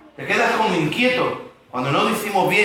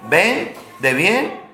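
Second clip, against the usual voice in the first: "Ben, de bien" said very slowly, spelled out; slow word breakdown; much slower than usual. Shown as a demonstration. Preacher breaking down bendición for the congregation, very slowly. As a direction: take each piece of "Ben, de bien" slowly. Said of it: "Viene del mismo".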